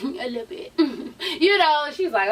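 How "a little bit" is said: sheepish laugh. Retold or imitated. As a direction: giggle it out sheepishly.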